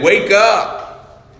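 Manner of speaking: high, loud call to wake up; ringing preached command; urgent preaching shout. Acted. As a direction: cry it out like a summons to repent. Preacher voicing Jesus' call.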